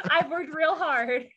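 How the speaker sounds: slow, laughing protest. Real, not acted. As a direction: laugh through the words. Laughing self-mockery.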